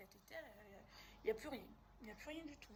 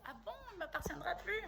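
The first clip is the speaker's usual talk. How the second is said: mock surprise; 7 semitones higher, 15 dB louder than usual. Her amused disbelief, retold.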